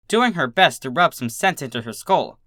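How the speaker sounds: ordinary delivery, nothing marked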